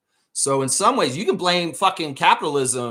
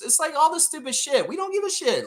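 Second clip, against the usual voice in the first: much higher, fed-up retort; exasperated outburst; 12 semitones higher than usual. Real, not acted. Host snapping back at critics, high and exasperated. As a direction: toss it off in disgust.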